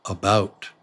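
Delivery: ordinary delivery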